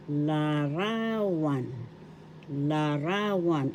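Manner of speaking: larawan slow, RA drawn out; stress on second syllable. Shown as a demonstration. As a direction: stretch the middle of larawan: la-RAA-wan. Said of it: "The accent is in the second syllable ra".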